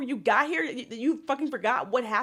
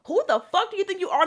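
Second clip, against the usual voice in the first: loud, high indignant challenge; outraged; 7 semitones higher, 6 dB louder, faster than usual. Real, not acted. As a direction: demand it in outrage. Rant at Meredith's audacity.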